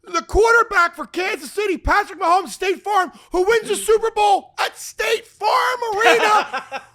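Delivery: high, loud, sustained comic tirade; hammed-up ranting. Comic laying out a football conspiracy, hammed-up ranting. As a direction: pound out each damning link at a yell.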